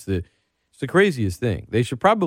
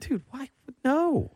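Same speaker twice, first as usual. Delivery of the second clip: high-pitched disbelief, softer; incredulous; 6 dB quieter, slower than usual. Real, not acted.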